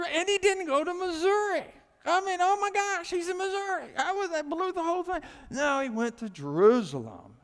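loud, high, ringing preacher's cry; full-force preaching. Acted. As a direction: shout it out to the whole church.

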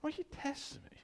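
high, soft knowing rebuke; Jesus knowingly. Retold or imitated. Jesus to the hypocrites, high soft knowing rebuke. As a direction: gently let them know you see it. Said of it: "He said".

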